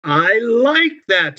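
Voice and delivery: put-on high, slow child's line; delighted child. A narrator's reading. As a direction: savour it like a pleased child. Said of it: "says Lauren".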